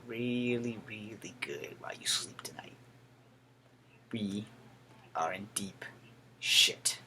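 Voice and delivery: low, very soft, near-whispered warning; quiet and weighty, word by word; grave hush. Real, not acted. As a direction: drop to a hushed, measured sign-off. Grave closing warning to viewers.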